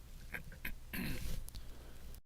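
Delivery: clears a frog from the throat; a rough clearing of the throat. Real, not acted. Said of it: "Sorry for the th-, frog in my throat".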